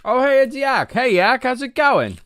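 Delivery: high, loud happy hello; excited greeting. Real, not acted. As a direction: greet a friend with delight. Greeting a chat viewer.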